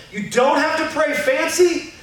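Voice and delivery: normal talking voice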